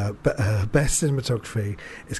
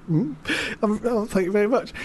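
a lightly voiced, higher, bashful cowboy thanks; Sam Elliott's cowboy blush; 8 semitones higher than usual. Imitating Sam Elliott's character thanking the dude, bashful and higher. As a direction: bashfully take the compliment like a shy cowboy. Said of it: "he does this little cowboy blush".

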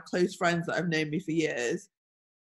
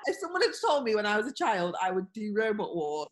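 usual speaking voice

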